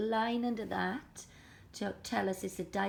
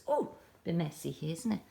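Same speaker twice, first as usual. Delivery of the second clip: ordinary talk, nothing marked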